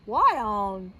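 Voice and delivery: gruff father's startled demand; Papa Bear. Acted. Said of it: "Papa Bear said".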